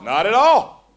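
"Not at all" called out loud with a rise; loud, emphatic reply after the whisper; loud answer. Shown as a demonstration. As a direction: belt "Not at all" back out. Same words answered aloud.

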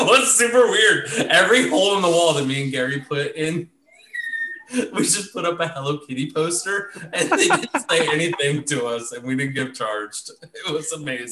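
gleeful laughing recollection. Real, not acted. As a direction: laugh while telling it. Laughing through the memory.

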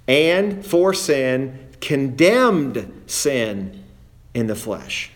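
very slow, each word drawn out; slow and weighty. Shown as a demonstration. Preacher to congregation, slowing right down for emphasis. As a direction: spell it out slowly so nobody misses it. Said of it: "Don't miss this".

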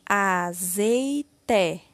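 AZEITE drawn out slowly as a, zei, te; syllable by syllable. Shown as a demonstration. Teacher completing the word with syllable té, slowly syllable by syllable. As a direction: sound out AZEITE one syllable at a time. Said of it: "Iremos utilizar a sílaba té".

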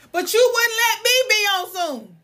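high, loud indignant outburst; pissed-off complaint. Real, not acted. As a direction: protest the unfairness with pitch way up. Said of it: "Ooh, I was so pissed".

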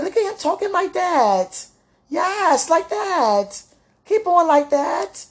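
high, loud, drawn-out egging on; retold cheering admiration. Woman recalling her sweetheart's way with words, cheering admiration. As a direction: cheer him on slowly in a high, proud voice.

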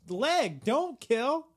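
high loud correction; mock God yelling. God correcting the soldiers, high and loud. Continuing God's complaint.

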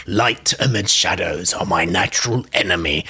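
low put-on accent of a dark being; Lex accent. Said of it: "said that with a Lex accent".

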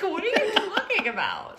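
high and loud, breaking into laughter; laughing disbelief. Real, not acted. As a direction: ask through laughter.

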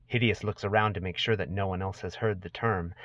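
ordinary talk, nothing marked

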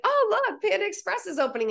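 very high, giddy imitation; squeaky, quick mock excitement; excited boyfriend. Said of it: "got so excited".

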